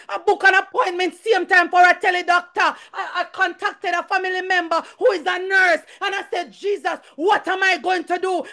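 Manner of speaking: high-pitched, urgent preaching; impassioned church testimony; fervent testimony. Real, not acted. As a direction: testify with rising fervour to the congregation.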